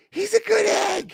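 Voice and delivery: very high, slow mock-Irish squeal; exaggerated Irish accent. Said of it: "they were from Ireland".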